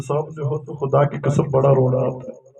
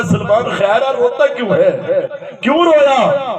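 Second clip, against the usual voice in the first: raised, insistent questioning; urgent concern; 7 semitones higher than usual. Retold or imitated. Prophet asks weeping Salman why he cries, urgent concern. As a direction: press the question, pitch climbing sharply at the end.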